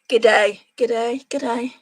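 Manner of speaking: practising an Australian "G'day" a few times, "G'day" said as "gud-day". Shown as a demonstration. Showing live viewers an Aussie hello, light Australian accent. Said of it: "Just think of hello in Australian".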